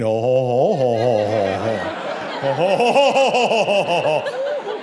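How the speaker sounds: mock a kid's drawn-out laugh, building in pitch; imitated "ho ho ho" laugh. Comic predicting how the sixteen-year-old will laugh, imitated laugh. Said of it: "That's how he's gonna laugh".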